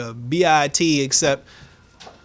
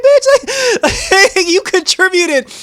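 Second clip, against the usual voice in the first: high, loud laughing outburst; yelled comeback, cracking up; laughing retort; 6 dB louder, slower than usual. Retold or imitated. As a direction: laugh through the insult. Vlogger's own angry retort.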